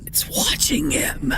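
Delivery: eerie hushed whisper. Real, not acted. Spotting the figure in footage.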